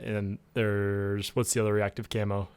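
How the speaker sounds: usual speaking voice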